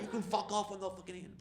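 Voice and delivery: high-pitched, softening mock refusal; retold stubborn refusal. Heckler refusing the crowd's urging, high mock indignation. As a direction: imitate a cornered heckler digging in.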